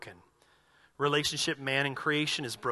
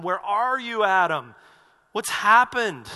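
God calling out; 4 semitones higher, 9 dB louder, slower than usual. Acted. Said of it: "God's calling Adam".

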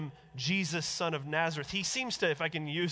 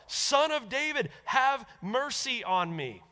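crying out; 6 semitones higher, 4 dB louder, slower than usual. A narrator's reading. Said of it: "he cried out all the more".

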